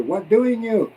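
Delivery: high, emphatic word-for-word gloss; literal translation emphasized. Shown as a demonstration. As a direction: punch DOING high, then fall on you. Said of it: "it really translates to".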